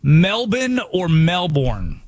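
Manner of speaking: "Melbourne" first as MEL-bin, then as MEL-born; two ways of Melbourne. Shown as a demonstration. Host weighing the two Melbournes for his cohost, slow contrast. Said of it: "here's the big controversy".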